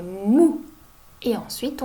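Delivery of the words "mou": MOU with a long m gliding into ou; blending sounds. Shown as a demonstration. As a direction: draw out "mou" slowly and firmly. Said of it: "M et ou, ça fait". (« mou » en liant lentement m et ou)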